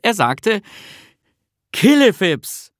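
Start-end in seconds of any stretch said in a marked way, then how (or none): 1.7-2.7 high, loud, slow and falling; triumphant magic word. A narrator's reading. Bertil finally grasps the magic word, loud and deliberate. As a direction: pronounce the spell slowly and loudly.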